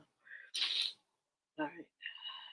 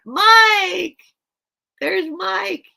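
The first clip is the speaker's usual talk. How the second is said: much higher, loud cry of the name; excited greeting; 12 semitones higher, 9 dB louder, much slower than usual. Real, not acted. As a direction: call out delighted at a friend. Greeting a viewer in chat.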